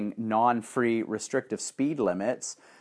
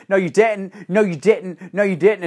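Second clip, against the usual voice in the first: higher, louder repeated protest; mock indignant yelling; 6 semitones higher, 5 dB louder than usual. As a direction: imitate an outraged girlfriend. Girl yells during quarrel.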